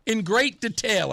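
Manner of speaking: ordinary talk, nothing marked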